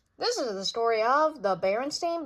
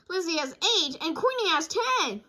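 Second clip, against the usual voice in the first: high, eager cub voice; eager child voice; 7 semitones higher, 5 dB louder than usual. Acted. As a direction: list them like a keen kid. Sister arguing.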